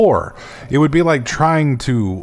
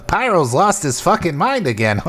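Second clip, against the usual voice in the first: higher mocking troll; 7 semitones higher, 4 dB louder than usual. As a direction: sneer like a troll. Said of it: "that's my new troll voice".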